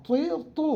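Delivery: তি and তু said high and distinct; drilling ti tu. Shown as a demonstration. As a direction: sing out তি, তু clearly. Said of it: "তাহলে আমরা".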